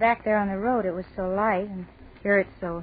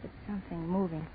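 frightened whisper; 7 dB quieter than usual. Acted. Frightened by something in shadows.

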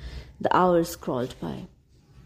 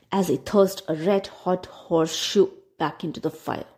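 ordinary delivery

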